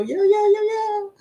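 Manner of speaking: high playful yo greeting to Eddie. Pretend. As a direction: bounce it like a hype call.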